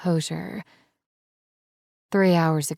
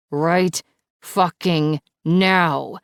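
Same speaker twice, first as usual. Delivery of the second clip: louder, slow, roared demand; roaring demand; 6 dB louder, much slower than usual. Acted. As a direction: drag out each word in fury. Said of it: "he roars".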